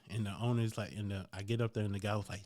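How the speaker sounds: ordinary delivery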